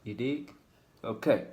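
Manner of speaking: ordinary delivery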